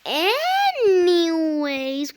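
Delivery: very slow, high and loud; drawn-out exclamation. Real, not acted. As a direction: stretch the word out loudly, then let it sink. Dragging out return to story.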